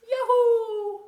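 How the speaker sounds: high, loud joyful whoop; real delighted yell; excited cheer. Real, not acted. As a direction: cheer it out like spring just arrived. Cheering first robin of spring.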